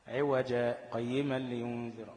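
slow recitation, brief silent stop after عوجا without breath; short stop after عوجا. Shown as a demonstration. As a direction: pause on عوجا, then go on to قيما. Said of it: "ما هتقف على عوجة". (سكتة لطيفة على عوجا بلا نفس)

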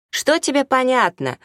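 high, angry challenge; flaring up. A narrator's reading. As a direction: snap back at him instantly. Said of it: "с полоборота завелась Анжела".

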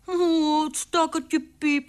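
put-on high, slow child voice; pitying little girl. A narrator's reading. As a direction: coo with pity as a small daughter. Daughter pities the squeaking mouse.

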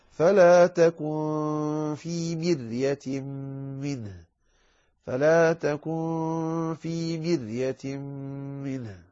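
slow tajweed chant with long held nasals; Quranic recitation. Shown as a demonstration. Teacher reciting the verse for students, slow tajweed chant. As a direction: recite slowly, sustaining the elongations.

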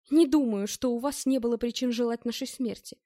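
ordinary delivery

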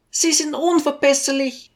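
high-pitched, impatient lady's voice; impatient housewife. A narrator's reading. Lady rejects the teacher's promise, impatient. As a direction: contradict him impatiently in a high voice. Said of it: "widersprach die Hausfrau ungeduldig".

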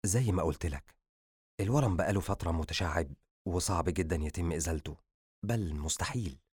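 quietly closing the door on hope; grave doctor. A narrator's reading. Doctor telling patient surgery is impossible, grave.

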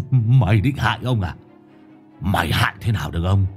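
gloating taunt. A narrator's reading. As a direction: taunt the dead wife. Madman gloats over corpse. (giọng chế nhạo đắc thắng)

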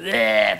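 mock car engine noise from the mouth; drawn-out "eee" car sound; imitated car noise. Shown as a demonstration. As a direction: blurt out a silly engine imitation. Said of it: "araba sesi çıkarmasını".

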